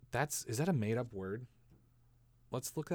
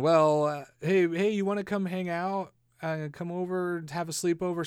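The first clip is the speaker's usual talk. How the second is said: put-on kid inviting; 6 semitones higher, 6 dB louder than usual. Kid inviting friend over.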